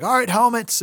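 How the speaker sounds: high barked coach call. Retold or imitated. Coach calls out to players.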